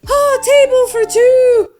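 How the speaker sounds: very high, loud, slow mock Irish; fake Irish accent. Elf girlfriend asking for a table, fake Irish accent. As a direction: sing out a cartoonish Irish request. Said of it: "speak in a fake Irish accent".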